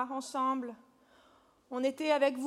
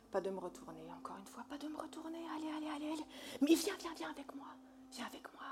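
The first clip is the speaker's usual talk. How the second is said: breathy seductive whisper; 4 semitones lower, 14 dB quieter than usual. Acted. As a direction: whisper it close and intimate.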